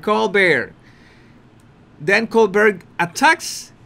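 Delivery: ordinary talk, nothing marked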